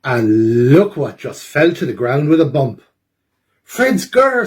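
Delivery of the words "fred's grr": much higher owl exclaiming. Acted. Eugene the owl spots Fred's stolen growl. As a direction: spot it like a little owl. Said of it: "says Eugene".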